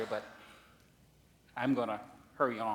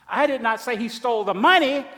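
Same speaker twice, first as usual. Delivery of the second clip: "money" punched, higher and louder; stress on MONEY; 10 semitones higher, 13 dB louder than usual. Shown as a demonstration. Preacher demonstrating the phrase, loud stress on MONEY. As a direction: land hard on "money". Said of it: "implying that he stole something".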